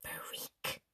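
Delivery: put-on a mean girl leaning over to hiss "Freak." at Mia. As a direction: spit "Freak." out as a nasty whisper. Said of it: "hissed in this really mean voice".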